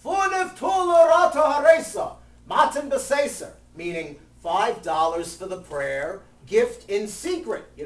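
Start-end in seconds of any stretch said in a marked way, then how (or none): 0.0-3.5 high, loud sing-song call across the shul; high, loud chanted shout of the pledge; yelled call. Retold or imitated. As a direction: call it out to the front of the sanctuary. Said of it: "He would yell it to the president".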